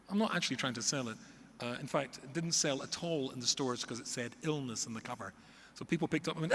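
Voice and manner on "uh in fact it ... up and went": ordinary delivery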